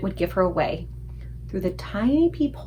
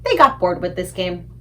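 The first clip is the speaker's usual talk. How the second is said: ordinary delivery, nothing marked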